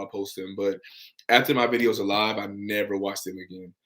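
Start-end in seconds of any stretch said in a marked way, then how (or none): none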